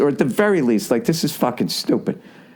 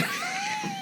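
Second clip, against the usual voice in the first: breathy high cackle; high giggle; 13 dB quieter than usual. Real, not acted. Comic laughing at his own impression, high giggle. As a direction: crack up after the joke.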